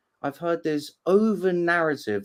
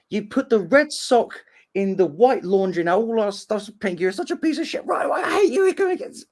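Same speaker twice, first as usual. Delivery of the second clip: high-pitched mock nagging tirade; hammed-up complaint climbing higher and higher; acted angry rant; 7 semitones higher than usual. Housemate furious about pink laundry, mock angry rant. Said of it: "If someone goes like".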